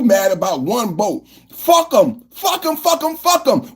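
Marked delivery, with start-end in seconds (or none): 1.5-3.7 defiant shout. Real, not acted. Ranting about blown-up boats.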